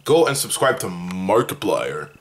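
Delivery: lightly voiced Markiplier, deep and low; Markiplier impression. Telling viewers to subscribe, in a lightly deep Markiplier voice. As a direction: drop into a hint of Markiplier's deep YouTuber voice. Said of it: "That's how he talks".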